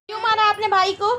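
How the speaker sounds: scolding shout. Real, not acted. Mother loudly scolds her toddler for slapping her brother. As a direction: demand an answer, loud and high.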